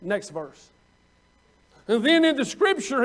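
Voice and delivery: ordinary delivery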